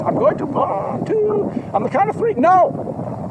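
very high, strained counting threat; squeaky dragon threat. Acted. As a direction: count down then cave in.

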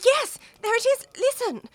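listen in alarm; alarmed urgency. Acted. Wife hears her husband arriving.

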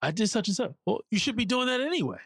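a hint of a stern father brushing off praise; old-school dad. Son imitates his traditionalist father shrugging off achievements, lightly mocking. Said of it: "it's old school".

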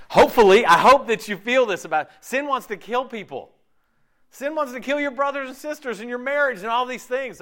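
fervent preaching. Real, not acted. Preacher warning the congregation about sin, fervent. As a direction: preach it hard at the crowd.

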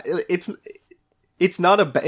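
ordinary delivery

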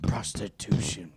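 hushed whisper. Acted. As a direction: breathe the word. Whispered aside.

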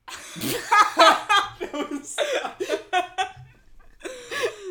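loud, high burst of laughter; big laugh. Real, not acted.

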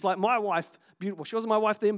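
ordinary delivery

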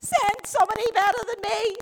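loud, high mock protest; exasperated plea. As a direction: push the refusal out forcefully. Acts the church pleading with God.

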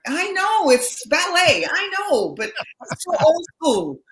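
high, loud mock-exasperated outburst; playful protest. Real, not acted. Protesting at being teased over valet.

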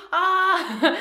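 short high panicky shriek; a hammed-up yelp of fear; mock scream. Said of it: "You may start screaming".

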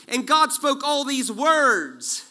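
slow and loud, each word weighted; slow emphatic reading. Shown as a demonstration. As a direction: read it slow and strong, like scripture. Reading scripture slowly, emphatic.

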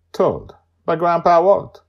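grand. Acted. Closing announcement.

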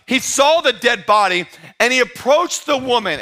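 ordinary talk, nothing marked

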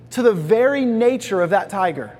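ordinary talk, nothing marked

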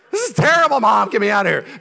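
high, very loud cry for rescue; mock panicked yell. Child begging Mom to get out, mock panicked yell. Mock outburst of someone desperate to leave.

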